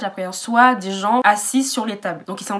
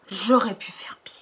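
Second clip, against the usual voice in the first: whispered aside. Real, not acted. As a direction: whisper it like a guilty secret. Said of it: "repeated aside". (murmuré en confidence)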